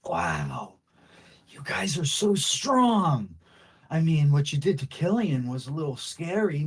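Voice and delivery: put-on star-struck young boy begging to join; awed kid voice. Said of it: "and he's like".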